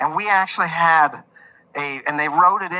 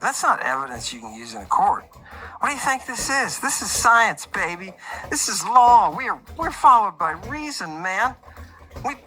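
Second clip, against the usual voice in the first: mock lawyer rant; 6 semitones higher than usual.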